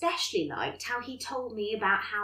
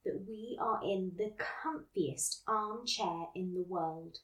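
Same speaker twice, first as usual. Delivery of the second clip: guided meditation; 3 semitones lower, 5 dB quieter than usual. Acted. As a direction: gently guide them to relax. Leads mindfulness exercise.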